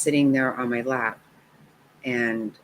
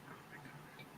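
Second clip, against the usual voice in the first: whispered gush; 30 dB quieter than usual. Real, not acted. Gushing over granddaughter.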